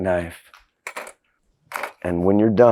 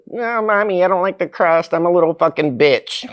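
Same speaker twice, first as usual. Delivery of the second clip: mock whiny kid; 8 semitones higher than usual. Mocks a child voice.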